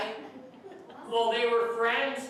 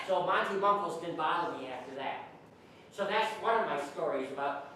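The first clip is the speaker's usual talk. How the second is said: normal talking voice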